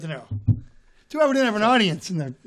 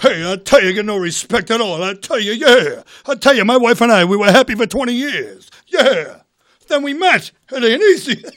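loud, put-upon comedian impression; Rodney Dangerfield; 3 semitones higher, 7 dB louder than usual. Old-school comic griping about his wife, loud and exasperated. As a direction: punch each complaint like a one-liner. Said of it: "You like Rodney Dangerfield?".

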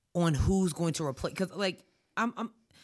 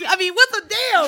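laughing; 11 semitones higher, 10 dB louder than usual. Real, not acted. Laughing fit after mix-up.

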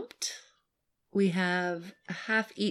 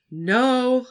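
higher, louder quick no; shouted back; 7 semitones higher, 6 dB louder than usual. Acted. Son shouting back to his mom, a loud no. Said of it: "I shouted back".

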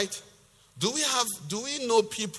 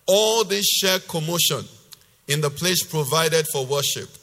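loud, emphatic preacher's reading; forceful scripture reading; 3 semitones lower, 4 dB louder than usual. Acted. Preacher reading God's rebuke to the congregation, loud and emphatic. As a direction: declaim God's rebuke with hard-hit key words. Reads the same passage in Message.

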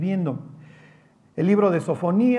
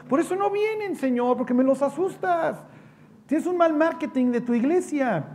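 high, whining complaint of a disciple; whiny Peter; 8 semitones higher than usual. Acted. Preacher voicing Peter griping to the Lord, high and whiny. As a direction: squeal it up high like a fretful follower.

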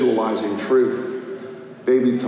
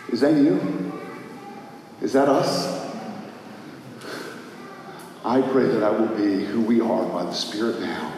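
normal talking voice

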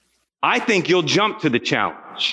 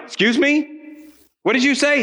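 incredulous; 8 semitones higher than usual. Retold or imitated. Incredulous father retelling reply.

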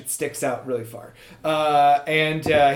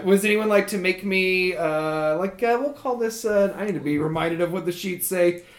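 normal talking voice

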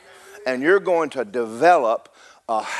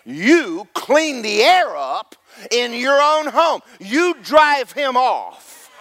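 preaching that climbs higher with each command; building sermon; 8 semitones higher, 4 dB louder than usual. Real, not acted. Preacher urging congregation to drive the devil out, building preaching. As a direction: build from firm exhortation to high-pitched full force.

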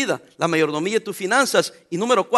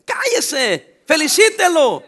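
regaño; 7 semitones higher, 4 dB louder, much slower than usual. Acted. Scolding the envious.

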